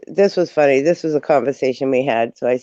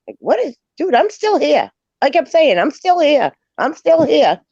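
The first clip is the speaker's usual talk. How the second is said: high, loud indignant insisting; pitched-up mock outrage; exasperated protest; 11 semitones higher, 4 dB louder than usual. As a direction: insist you're still here, fed up. Frustrated about being ignored.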